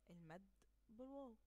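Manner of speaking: ordinary talk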